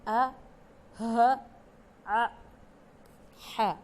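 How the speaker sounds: each throat sound said alone and crisp; isolated letter sounds. Shown as a demonstration. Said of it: "کیا کیا sounds ہیں؟". (ہر حرف کی آواز الگ الگ، صاف)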